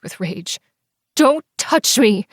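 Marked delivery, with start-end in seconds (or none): 1.1-2.3 high loud slow cry; yelping. Acted. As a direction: yelp it in panic. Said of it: "I yelped".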